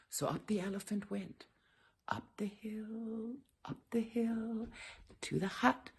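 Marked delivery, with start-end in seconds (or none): 2.1-5.9 whispered repetition; hushed refrain. A narrator's reading. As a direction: tiptoe it up the hill.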